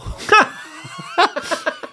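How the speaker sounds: breathy high-pitched laugh; high laugh at himself. Real, not acted. Man laughs at his own confession, high laugh. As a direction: laugh at your own joke.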